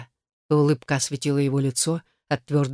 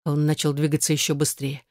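ordinary delivery, nothing marked

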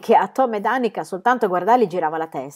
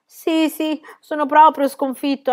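higher, breathless panting surrender; 8 semitones higher, 5 dB louder, slower than usual. A narrator's reading. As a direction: gasp it out, winded. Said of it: "ansimò il capitano Calzelunghe".

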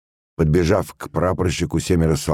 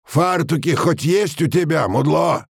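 sergeant's high, rough-mannered yell; shouted after him; 12 semitones higher than usual. A narrator's reading. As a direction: yell at the back of a running man. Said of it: "крикнул старшина в спину бегущим". (крикнул в спину)

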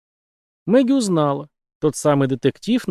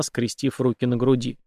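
ordinary delivery

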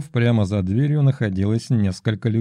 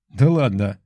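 guffawing; 3 dB louder than usual. Acted. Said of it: "загоготал головорез".

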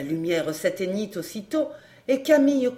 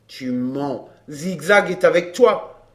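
low-pitched accusation swelling louder; accusing man; 5 semitones lower, 5 dB louder, slower than usual. A narrator's reading. Man accusing her of hiding Zigzag, grim. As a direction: start low, then press it harder.

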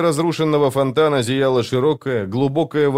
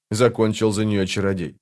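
plain reading voice

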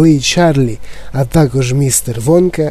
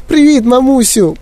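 put-on tiny high-pitched boy voice; squeaking; 10 semitones higher than usual. A narrator's reading. Shrunken boy greeting his mother, squeaking. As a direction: squeak it like a little mouse. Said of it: "пищав Майк".

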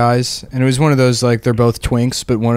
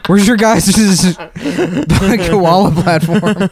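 laughing through a quote; 6 semitones higher than usual. Retold or imitated. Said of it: "he's like".

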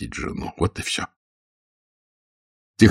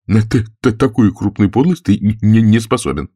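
raised stutterer's voice; stuttering character retort; stuttering protest; 4 semitones higher, 3 dB louder than usual. Acted. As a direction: stumble on T and N as you object. Stuttering Gursky voiced in dialogue.

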